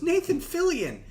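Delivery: high, loud cut-off shout; excited shout. Retold or imitated. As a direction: blurt the name out excitedly. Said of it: "all I said was".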